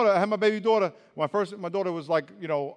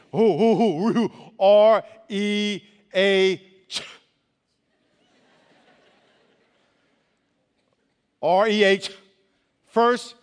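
loud preaching; 2 semitones higher, 8 dB louder, much slower than usual. Acted. Pastor spelling out reach to the youth, slow loud preaching.

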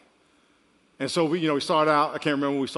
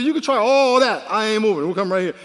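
louder stern grandpa; firm loud refusal; 4 semitones higher, 6 dB louder than usual. Retold or imitated. Grandfather refusing to budge for the grandson. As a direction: stand your ground. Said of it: "I said".

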